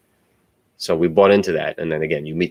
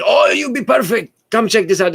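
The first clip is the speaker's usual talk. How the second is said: much higher, excited imitation of the cousins calling him over; high, louder pitch of a keen cousin recruiting him; eager cousins; 4 dB louder than usual. Cousins inviting him to listen.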